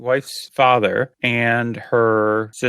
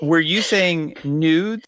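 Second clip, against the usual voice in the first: slow puzzled question; 4 semitones higher, much slower than usual. Real, not acted. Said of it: "what you're saying".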